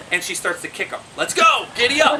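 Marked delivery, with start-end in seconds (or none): 1.2-2.2 high, loud rider's call; urging on. Retold or imitated. Monkey riding the bulldog, excited urging. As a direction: call out like a jockey spurring a mount.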